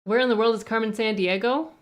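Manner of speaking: ordinary talk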